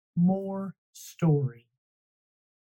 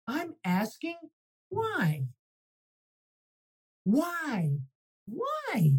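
whiny why; 6 semitones higher, slower than usual. Acted. Pigeon pestering with repeated why.

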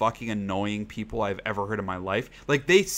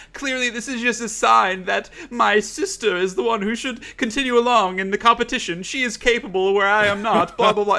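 high, loud pompous mock voice; mocking the twin; 10 semitones higher, 4 dB louder than usual. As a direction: lay it on thick as the self-pitying twin. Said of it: "she was like".

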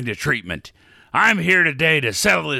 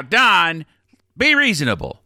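ordinary talk, nothing marked

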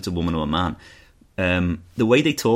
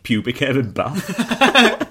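slow, amused and louder; laughing; 5 semitones higher, 7 dB louder, much slower than usual. Retold or imitated. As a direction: giggle through the line. Reading out a rude review.

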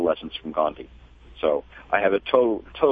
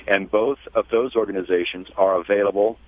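ordinary delivery

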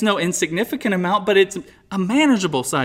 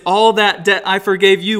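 "all" hit first and hardest; stress on all; 2 semitones higher, 6 dB louder than usual. Shown as a demonstration. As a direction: lean on "all" up front. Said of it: "puts all the emphasis on all".

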